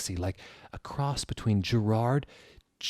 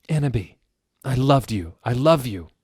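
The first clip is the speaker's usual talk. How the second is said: loud, hammed-up declaration; mock sad shout; 4 semitones higher, 7 dB louder than usual. Draco shouting his love, loud mock-sad. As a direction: cry out love, overdone. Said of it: "he shouted sadly".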